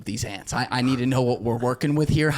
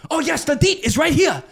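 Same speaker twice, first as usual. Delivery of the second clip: mock eager reply; 8 dB louder than usual. Friend's retold reply.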